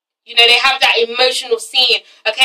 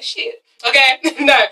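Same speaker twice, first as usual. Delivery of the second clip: normal talking voice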